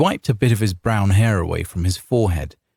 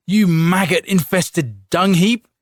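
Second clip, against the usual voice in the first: furious insult; 10 semitones higher, 4 dB louder, slower than usual. A narrator's reading. Husband curses the soldier.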